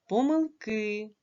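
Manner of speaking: slow "Помилки" with a long stressed final "ки"; last-syllable stress. Shown as a demonstration. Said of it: "буде падати на останній склад". (повільно "Помилки", наголос на останній склад "ки")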